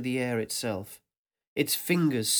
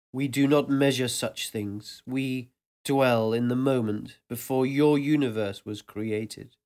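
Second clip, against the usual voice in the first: vast ancient voice. Acted. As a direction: speak as a presence that surrounds everything. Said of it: "this new voice".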